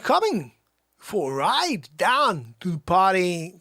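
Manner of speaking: slow, halting, oddly punched words; Christopher Walken. Acted. Film star offering a ride to the party, Walken impression. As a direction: break the phrase in odd places. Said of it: "Christopher Walken".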